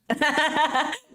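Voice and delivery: a short run of giggles; laughing. Real, not acted. Artist amused by own drawing of grandma, laughing.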